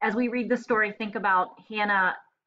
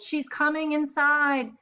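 higher, slow, hammed-up groan of dread; mock groan; 7 semitones higher, 4 dB louder, slower than usual. Shopkeeper McDougal dreading Hannah's arrival, mock groan. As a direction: moan it like trouble is walking in. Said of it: "groaned McDougal".

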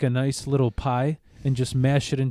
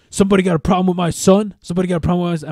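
loud, fast, higher confrontational dad; mock angry shout; 5 semitones higher, 6 dB louder, much faster than usual. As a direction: bark it fast like a dad picking a fight. Ex-husband confronting, retold.

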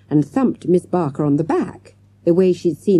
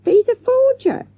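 protesting; 5 dB louder than usual. A narrator's reading. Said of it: "Avril's muffled voice protested".

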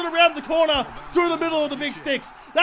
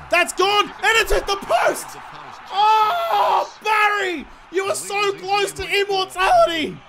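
yelling as the kick drifts onto the post; excited then gutted; 7 semitones higher, slower than usual. Real, not acted. Gamer watching his kick hit the post, anguished shout.

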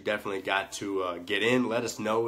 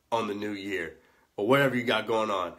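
normal talking voice

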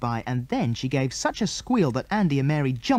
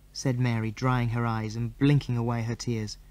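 ordinary delivery, nothing marked

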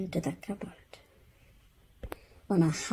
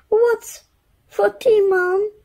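put-on much higher, eager child; 6 dB louder than usual. Laura asking mum about tea, high and eager. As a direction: pipe it up like a hungry kid.

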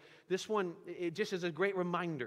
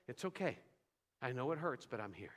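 softer, lower, fading gently to near silence; tender comfort; 5 semitones lower, 6 dB quieter than usual. Acted. God comforting a person bawling on the floor, soft and tender. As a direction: say it like holding someone who is crying.

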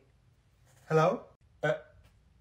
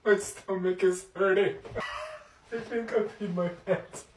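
laughing; 6 dB quieter than usual. Acted. Laughing fit after fall.

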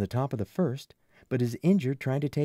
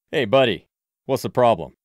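much louder confrontational call; loud challenge; 10 dB louder than usual. A narrator's reading. Frank confronting the man bothering the kids, loud and challenging. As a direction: step in loudly to stop him.

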